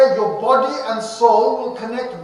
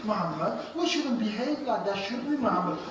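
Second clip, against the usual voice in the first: much softer, gentle and sing-song; soft teacher voice; 3 semitones lower, 10 dB quieter than usual. Retold or imitated. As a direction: coax him like a patient teacher. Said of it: "He said".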